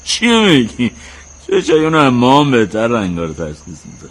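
high, loud objection; incredulous protest. Acted. Brother defending father's eyesight, loud incredulous protest. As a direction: object at once, pitch jumping up.

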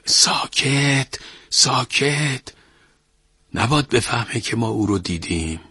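hurried panicky hush; flustered hush. A narrator's reading. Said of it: "با دست پاچگی گفت".

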